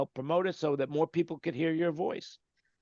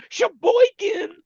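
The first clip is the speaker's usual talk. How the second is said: Sheboygan! belted much higher, slow and stretched; mock announcer shout; 7 dB louder, much slower than usual. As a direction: call out Sheboygan like a game-show host. Mock-announces the city name.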